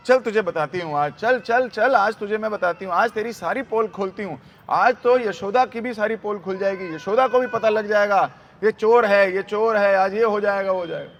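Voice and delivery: loud, insistent acted tirade; गुस्सैल Gopi. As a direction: threaten to expose the thief, hammering each phrase. Gopi Krishna को घसीटती हुई. (ज़ोर से धमकाती गोपी)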